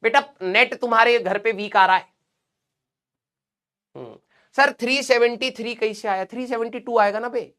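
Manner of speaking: usual speaking voice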